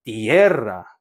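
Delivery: 띠에라 with weight on the 에; stress on strong vowel. Shown as a demonstration. Teacher repeating the corrected word, 에 stressed in 띠에라. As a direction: say 띠에라 low, leaning on 에. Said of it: "강모음에 강세가 갑니다".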